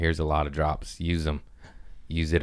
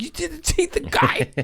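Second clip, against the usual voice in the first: shrieking outrage. Retold or imitated. Yelling at his passengers about the near T-bone, in a frantic high shriek. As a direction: voice leaps far higher in a frantic yell. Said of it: "I was like".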